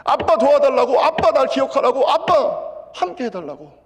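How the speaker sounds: loud, high pleading preaching cry; impassioned preaching. Acted. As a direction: cry out to God like a child to its father. Preaching climax, crying prayer voiced. (아버지를 부르짖듯 높고 크게 간절히)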